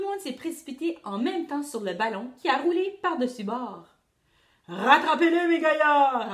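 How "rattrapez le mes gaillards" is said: loud pirate command; captain loudly ordering his crew. A narrator's reading. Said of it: "a ordonné Barbe de Bouc".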